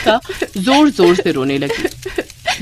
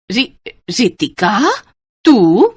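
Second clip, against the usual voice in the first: shocked stammer; 2 semitones higher, 3 dB louder, much slower than usual. Acted. Babita shocked seeing Ritika.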